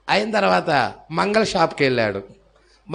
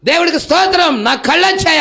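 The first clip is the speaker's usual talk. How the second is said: higher, louder mock shout of praise; retold joyful shout; 9 semitones higher, 6 dB louder than usual. Blind man answering the fisherman with a loud shout. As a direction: call it out, overjoyed at seeing. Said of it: "ఏంటి పెద్ద కేకలు పెడుతున్నావు".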